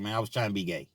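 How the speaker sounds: put-on embarrassed drunk friend explaining; sheepish confession. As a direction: mumble it shamefaced. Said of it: "He had to say".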